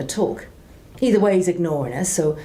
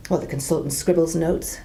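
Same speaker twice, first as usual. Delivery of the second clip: ordinary talk, nothing marked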